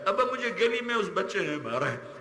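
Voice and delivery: high-pitched cry of complaint to father; beaten child complaining. Acted. Beaten child tells father who hit him, pleading complaint. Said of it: "جیسے بچہ پٹ پٹا کے".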